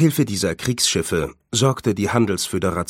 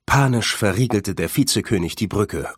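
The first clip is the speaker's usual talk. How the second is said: usual speaking voice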